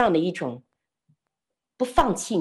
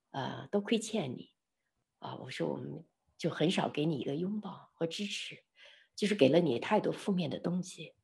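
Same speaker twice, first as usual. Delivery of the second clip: much softer, low remorseful voice; retold gentle apology; 3 semitones lower, 9 dB quieter than usual. Speaker apologising to a friend in a group hug, tender.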